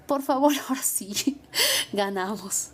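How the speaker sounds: giggling hushed aside; whispered with a laugh. Retold or imitated. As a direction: whisper it, half laughing, relieved. Her own words after winning.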